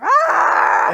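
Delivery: sudden high shout of attack; battle cry. Acted. Player shouting a war cry as she charges. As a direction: charge in yelling.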